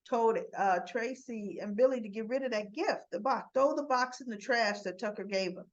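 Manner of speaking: normal talking voice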